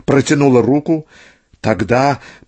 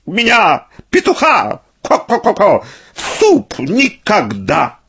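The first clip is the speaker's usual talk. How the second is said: high, indignant rooster squawk; proud rooster clucking in protest; crowing rooster; 7 semitones higher than usual. A narrator's reading. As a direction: crow the words, high and outraged. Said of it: "и кукарекнул".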